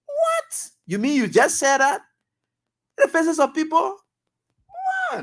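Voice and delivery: scandalised at what was said in public; shocked disbelief. Real, not acted. Host shocked by president's words.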